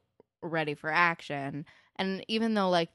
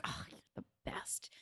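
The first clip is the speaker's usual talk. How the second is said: very soft, voiceless gushing; awed whisper; 14 dB quieter than usual. Retold or imitated. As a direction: whisper it in retold admiration. Gushing over a hero, retold.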